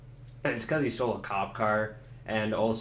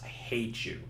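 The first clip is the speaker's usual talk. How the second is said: flat muttered hate; 2 semitones lower, 8 dB quieter than usual. Acted. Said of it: "she's like".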